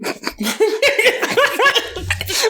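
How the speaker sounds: high burst of real laughter; long, high real laugh; laughing fit. Real, not acted. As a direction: crack up helplessly, high-pitched.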